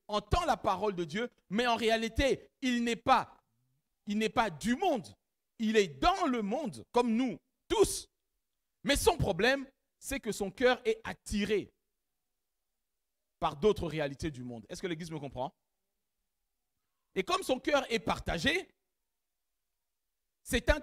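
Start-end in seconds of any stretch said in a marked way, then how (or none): none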